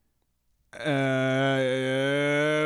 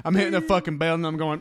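normal talking voice

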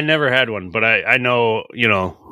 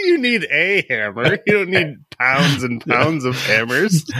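laughing; 5 semitones higher, slower than usual. Real, not acted. Teasing through laughter.

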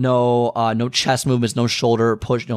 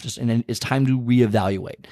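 ordinary delivery

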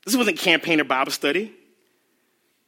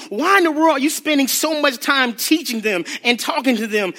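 ordinary talk

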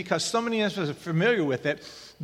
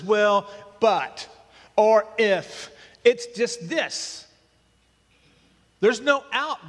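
preaching harder, louder and higher, no exceptions; building sermon; 3 semitones higher, 3 dB louder than usual. Acted. Pastor insisting to his church there is no exception, forceful preaching. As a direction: hammer it home to the congregation.

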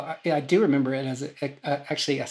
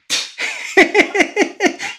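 high, loud burst of laughter; big laugh; 8 dB louder than usual. Real, not acted. Award winner remembering his own mix-up, real laughter. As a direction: really crack up at the memory. Recalling not knowing he won.

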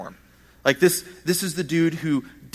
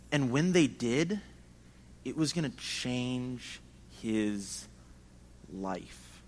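fading to hush; 3 semitones lower, 7 dB quieter, much slower than usual. Real, not acted. Dramatic close of point.

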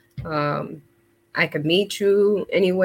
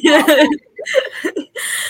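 teary laugh; 9 semitones higher, 5 dB louder than usual. Real, not acted. Sees her husband, overcome.

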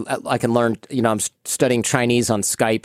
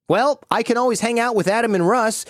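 high, airy mock-casual suggestion; mock nonchalance; 10 semitones higher than usual. Teen to parents about hanging out with troublemakers, mock nonchalance. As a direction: float it innocently, pitched well up. Threatens parents with bad company.

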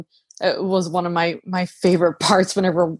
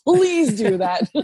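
laughing plea; 4 semitones higher, slower than usual. Retold or imitated. Repeated eager plea, laughing.

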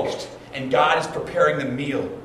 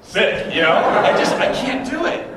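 flustered protest; 9 semitones higher, 6 dB louder than usual. Retold or imitated. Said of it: "I'm like".

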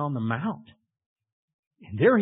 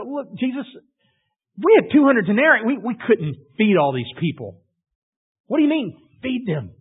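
high, loud, sarcastic protest; sarcastic disciples; 7 semitones higher, 6 dB louder than usual. Acted. Disciples sarcastically answer Jesus, high and scoffing. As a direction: scoff at the idea, pitched up high. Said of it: "they're kinda sarcastic".

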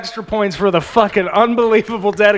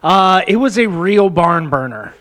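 ordinary talk, nothing marked

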